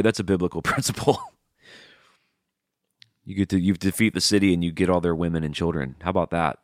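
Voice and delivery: ordinary talk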